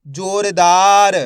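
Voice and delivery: जोरदार slow and loud, vowels stretched; जोरदार drawn out for learners; blended word. Shown as a demonstration. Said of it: "तो मिलके बन जाएगा".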